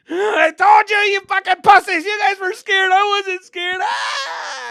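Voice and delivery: high, loud cocky yelling; boastful shouting. Retold or imitated. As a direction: brag at the top of your lungs. Bragging loudly after ride.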